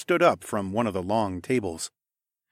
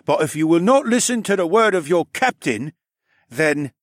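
loud, higher, stern warning; stern rebuke; 8 semitones higher, 6 dB louder than usual. Acted. Captain scolding disobedient miners, loud stern voice. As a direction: lay down the law.

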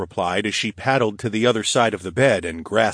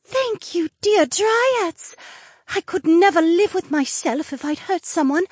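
put-on high, earnest young woman; grateful and sincere; young mermaid voice.

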